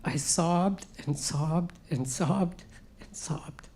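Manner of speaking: breathless near-whisper of grief, slow; broken, sobbing whisper. Retold or imitated. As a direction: let the words dissolve into tears, barely voiced. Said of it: "and I broke".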